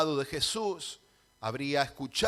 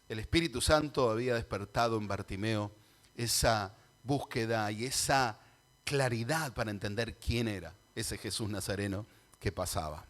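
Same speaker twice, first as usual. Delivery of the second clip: ordinary talk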